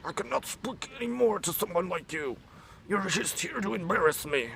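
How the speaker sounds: very high, hammed-up outraged wail; imitated offended man. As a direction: exaggerate a student's wounded indignation. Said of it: "men would just be like".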